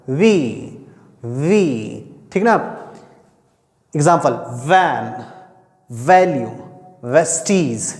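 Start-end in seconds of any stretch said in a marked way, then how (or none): 0.1-2.3 वी with lower lip lightly touching the upper teeth; a clear English V as in वी; V sound demo. Shown as a demonstration. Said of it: "होठ हल्का सा सटना चाहिए और ये ऊपर वाले दांत से". 3.9-7.9 वैन and वैल्यू with lip-to-teeth V; teacher modelling the V sound in words; V example words. Shown as a demonstration. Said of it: "ऊपर वाले दांत से हल्का सा इसको टच करना है".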